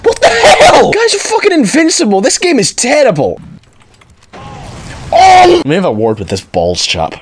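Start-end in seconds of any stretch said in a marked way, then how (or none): 0.0-3.4 high-pitched gamer rage; loud outburst, pitched right up; angry yell. Real, not acted. Raging at invincible enemies. 4.3-5.5 high, sudden wail; high-pitched yelp; frustrated yell. Real, not acted. Killed in the game.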